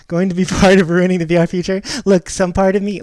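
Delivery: loud laugh through the words. Real, not acted. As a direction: laugh it out while speaking. Joking about ruining VR.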